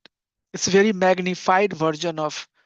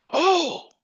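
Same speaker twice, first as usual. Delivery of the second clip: high-pitched, worried "oh"; acted motherly gasp of concern; alarmed mother; 12 semitones higher than usual. Mother finds her daughter crying, acted alarm.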